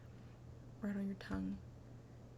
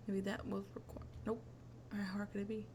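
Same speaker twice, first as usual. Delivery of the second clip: ordinary delivery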